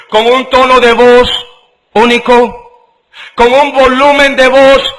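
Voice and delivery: mounting fervent sermon; rising preaching climax. Acted. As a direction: build it up to the peak.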